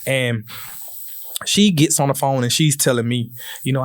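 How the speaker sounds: normal talking voice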